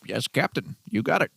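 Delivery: put-on high, chirpy kid's reply; eager child. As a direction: answer like an obedient little kid. Child answers captain.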